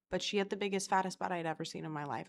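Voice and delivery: ordinary delivery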